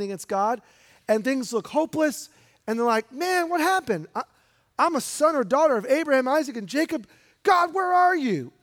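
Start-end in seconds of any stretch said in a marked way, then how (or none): 3.1-8.5 high, pleading cry to God; despairing cry. Retold or imitated. Preacher voicing Israel's despair to God, high pleading cry.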